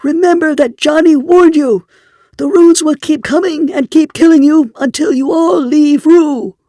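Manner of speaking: very high, loud, wild-eyed shout; shrill screech. A narrator's reading. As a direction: shriek the warning at the crowd. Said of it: "His shouts".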